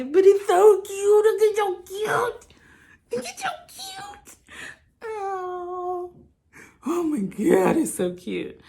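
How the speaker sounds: high, slow, teary squeal over something cute; tearful squeal. Real, not acted. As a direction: melt over the tiny outfit, near tears. Squealing over the outfit again.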